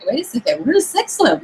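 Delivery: re-enacted dawning surprise, leaping up in pitch; sudden realisation. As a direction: act out a high-pitched "hold on" discovery. Acting out their sudden realisation.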